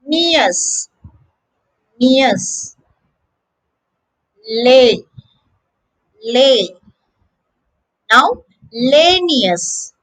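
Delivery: isolated word endings, each given space; repeat-after-me drill. Shown as a demonstration. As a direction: model each piece slowly for learners to copy. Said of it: "learn it in the reverse".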